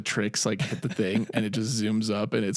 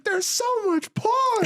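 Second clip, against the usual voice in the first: very high, slow, drawn-out squeal of shock; Ted voice; slower than usual. Retold or imitated. Ted discovers the hidden porn files, high shocked squeal. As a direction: squeak it out like a stunned teddy bear. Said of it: "And he's like".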